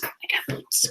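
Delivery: hushed boast; whisper. Acted. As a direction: whisper it proudly. Whispered boots reveal.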